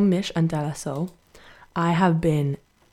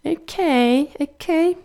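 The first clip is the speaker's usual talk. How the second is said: Amanda character; 10 semitones higher, 4 dB louder, much slower than usual. Acted. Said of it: "weird little character that I do".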